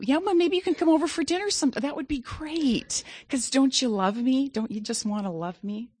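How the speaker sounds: high, sugary mock-sweet voice; mock fawning fan. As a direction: gush like a fan angling for dinner. Mimicking fawning business associates.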